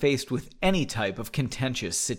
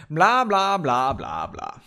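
"blah" rattled off higher and louder; mock skimming; 3 semitones higher, 3 dB louder, slower than usual. Reader skips dull text with a "blah". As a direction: skip it with a bored "blah".

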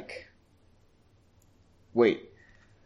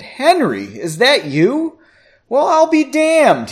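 high, loud, excited greeting; delighted exclamation; 12 semitones higher, 7 dB louder, slower than usual. A narrator's reading. Husky man greeting his old friend Henry, exclaiming. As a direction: burst out in happy astonishment. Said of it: "he exclaimed".

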